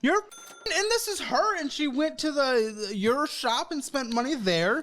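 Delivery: high-pitched exasperation, slowing to a halt; exasperated. Real, not acted. Host finds her spending on the card, exasperated. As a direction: splutter it high, words breaking off.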